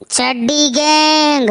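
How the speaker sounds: slow, drawn-out gang voice; menacing thief. Acted. Gang thief answering who's there, slow and menacing. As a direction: stretch it out like a thug in the dark. Thief announcing themselves in the dark.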